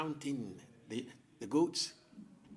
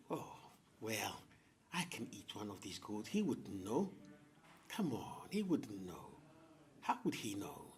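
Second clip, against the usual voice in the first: drowsy leopard plotting under his breath; scheming leopard; 2 semitones lower than usual. Acted. Leopard dreams of eating goats.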